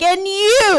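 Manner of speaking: put-on loud, high-pitched drunken call-out; single out the next culprit, loudly; drunken accusation. Drunk patient rounding on technician, loud accusation. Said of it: "I sound drunk and I feel drunk".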